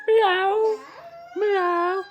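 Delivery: high-pitched playful meowing; cat meow. Pretend. Dad playing animal sounds with his child, high cat meow. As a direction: mew like a little cat. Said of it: "Can you make a cat sound?".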